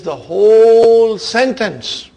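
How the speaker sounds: "whole" stretched long, loud and high; loud, slow, drawn-out WHOLE before "sentence"; emphatic stress. Shown as a demonstration. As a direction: hammer "whole", drawing it right out. Teacher stressing what lam emphasizes.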